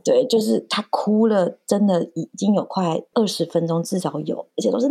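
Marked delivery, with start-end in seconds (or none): none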